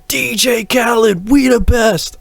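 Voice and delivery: high yelled hype drop; [name] shout. Retold or imitated. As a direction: shout it like a DJ tag. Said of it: "you'd hear".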